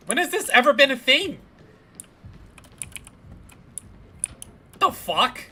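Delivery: sudden high startled yell; shocked shout. Real, not acted. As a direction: yell it out in disbelief. Shocked by door opening.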